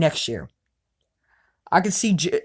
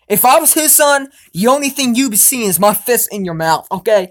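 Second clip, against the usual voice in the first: higher, louder heated threat; real anger; 4 semitones higher, 5 dB louder than usual. Real, not acted. A fan raging at Brady on camera, heated and loud. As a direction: spit out the threat like you mean it.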